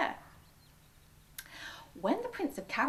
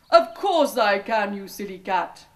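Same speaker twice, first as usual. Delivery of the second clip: ogre's boast; 11 dB louder than usual. A narrator's reading. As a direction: sneer it like a boastful ogre. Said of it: "he said".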